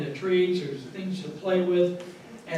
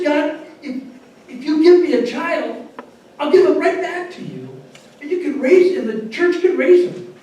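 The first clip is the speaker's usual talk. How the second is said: higher, louder desperate plea to God; pleading prayer; 9 semitones higher, 9 dB louder than usual. Retold or imitated. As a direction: beg God for a child, loud and high. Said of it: "She said".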